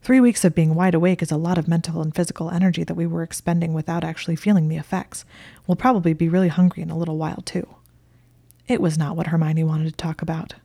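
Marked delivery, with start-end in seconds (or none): 0.0-7.7 fast, chatty patter; rapid conversational. Acted. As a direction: rattle it off quickly. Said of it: "in his rapid conversational tone".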